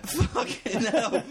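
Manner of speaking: laughing. Real, not acted. Laughing outburst.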